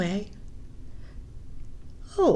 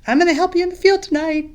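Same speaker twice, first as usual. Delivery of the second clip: higher, louder, fast and bubbly; excited; 8 semitones higher, 8 dB louder, faster than usual. Acted. Batu promising to help in the field, excited. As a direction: younger brother thrilled to help.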